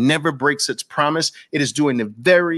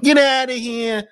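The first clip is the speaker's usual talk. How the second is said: long, dismissive Brooklyn holler; old Brooklyn; 9 semitones higher, 3 dB louder than usual. Acted. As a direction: scoff it like a Brooklyn local. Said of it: "how we used to say it in Brooklyn".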